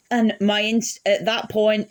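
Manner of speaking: ordinary talk, nothing marked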